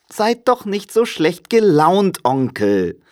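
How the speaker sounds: high, lively plea; jolly young relative cajoling; cheerful nephew, coaxing. A narrator's reading. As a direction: coax a grumpy uncle. Nephew coaxes grumpy uncle.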